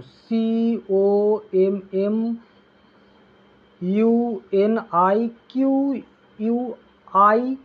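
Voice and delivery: very slow, letter by letter: ইউ এন আই কিউ ইউ আই; spelling out letters. Shown as a demonstration. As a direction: spell each letter apart with long gaps. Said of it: "বানানটা দেখো".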